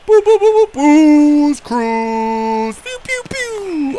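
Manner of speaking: high, slow drawn-out booze cruise call; sing-song noises while skating; silly sound effects. Pretend. Player hyping his own rush, silly high sound effects.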